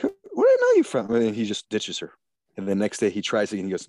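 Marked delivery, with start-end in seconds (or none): 0.0-1.1 very high, louder put-on voice; imitated film character. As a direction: squeal it in mock falsetto. Acts out film dialogue.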